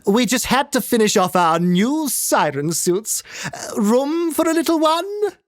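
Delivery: high, gushing society lady; chirpy socialite squeezing in; gaily. Acted. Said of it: "she said gaily".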